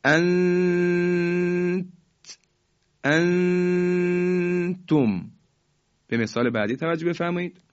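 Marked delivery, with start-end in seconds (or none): 0.1-1.9 long high nasal hum on «انت», stopping at the ت; «انت» drawn out very slowly, tongue waiting at the t; ikhfa held short of release. Shown as a demonstration. 3.0-5.3 «انتم» with the noon drawn out in a long nasal hum; very slow «انتم», long ghunna before the ت; ikhfa. Shown as a demonstration.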